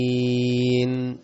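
"آمين" بمد ست حركات طويل جدا; اسحب "آمين" ست حركات; six-count amen. Shown as a demonstration. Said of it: "ست حركات تنفع برضه". (آمين بمد ست حركات)

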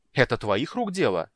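loud angry accusation; angry blurt. A narrator's reading. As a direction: blurt it out in anger. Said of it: "сердито выпалила Ладонна".

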